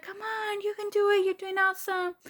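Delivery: high, soft cheering nurses; cheer squad. Retold or imitated. Nurses encouraging a woman in labor, imitated cheer squad. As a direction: cheer them on in a small high voice. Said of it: "cheer squad or something".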